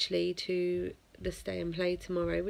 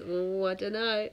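ordinary delivery

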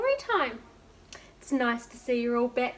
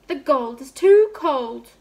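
slow, louder complaining king; king crying out; 11 dB louder, slower than usual. Acted. The king complaining about his gold-mended sock, slow and loud. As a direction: wail the complaint like a fussy king. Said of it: "he cried".

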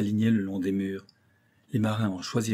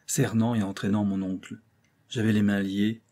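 ordinary delivery

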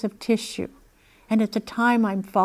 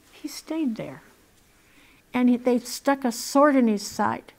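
ordinary delivery

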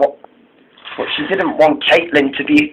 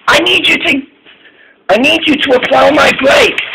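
high-pitched, pressing request; urgent plea; 11 semitones higher than usual. A narrator's reading. Molly needs her driver to brake, urgent.

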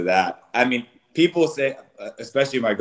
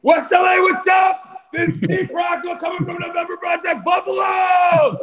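much higher, loud hype shout; hype group shout; 6 dB louder than usual. Shown as a demonstration. Introducing himself to the workout group, loud hype shout. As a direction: pump up the crowd at full volume. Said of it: "introducing yourself to the group".

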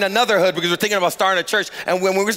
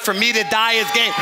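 ordinary talk, nothing marked

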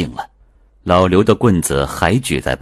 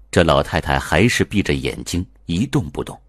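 plain reading voice

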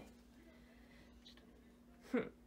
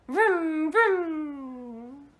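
car noise; 6 semitones higher, 9 dB louder than usual. Shown as a demonstration.

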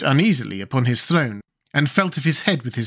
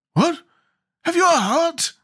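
high-pitched, startled question; surprised. A narrator's reading. Scarecrow marvels that Jack has a heart, surprised. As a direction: ask in astonishment, pitched way up.

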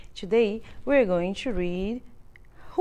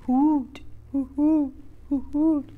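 owl hoot; 3 semitones higher than usual. Acted. Owl sound in story.

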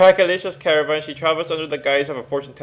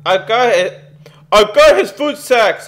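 higher, louder, slowed battle yell; mock yell; 7 semitones higher, 8 dB louder than usual. Gamer voicing his hero dashing into the mob, mock yell. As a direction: yell it like charging into a crowd. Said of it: "I'ma yell".

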